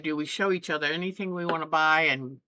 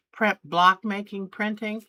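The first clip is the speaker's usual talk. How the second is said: ordinary delivery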